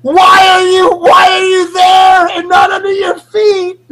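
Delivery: high, loud, wailing plea at the board; mock anguished wail. Friend staring at his board, wailing at it loudly. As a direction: cry out to the skateboard in mock despair.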